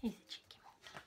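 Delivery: ordinary delivery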